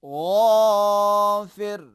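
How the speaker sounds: غافر with a long throaty غ; غافر held long from the throat; throaty ghain. Shown as a demonstration. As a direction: stretch غافر out slowly in a recitation tone. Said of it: "یہ حرف غین کا مخرج ہوا".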